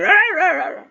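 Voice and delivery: high yapping burst, fading; imitated dog barking. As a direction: bark like the dog starting up. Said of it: "he came".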